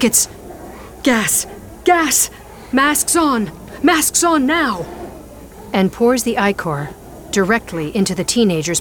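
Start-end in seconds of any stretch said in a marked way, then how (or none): 0.0-4.9 rising panic to a high shout; building from worried to frantic alarm; panicked plane decompression. Acted. Gas alarm in the nightclub.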